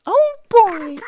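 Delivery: loud, very high cartoon squeal; put-on cartoon mouse exclamation; Mickey Mouse. Shown as a demonstration. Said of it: "familiar with Mickey Mouse's intonation".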